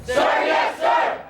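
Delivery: loud cadet shout, sir yes sir; shouted reply. Real, not acted. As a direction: bark it back like a cadet. Cadets answer drill call.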